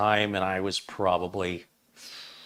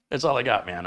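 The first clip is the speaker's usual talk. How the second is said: ordinary talk, nothing marked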